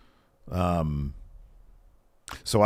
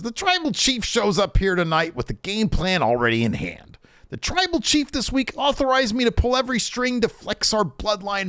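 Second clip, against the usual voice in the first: Heyman announcer voice; 9 semitones higher than usual. Retold or imitated.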